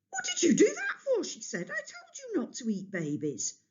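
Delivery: very high outraged cry with a laugh; exasperated again; exasperated mother. A narrator's reading.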